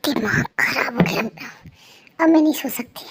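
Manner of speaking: whispered, drained and exasperated; tired hushed complaint; fed-up whisper. Acted. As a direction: breathe it out like you've had no sleep. Wife groaning after bad night.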